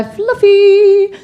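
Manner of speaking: high, loud, drawn-out word; gleeful squeal. Acted. Women groomed like dogs, gleefully high and drawn-out. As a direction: stretch it out high like a pampered pet.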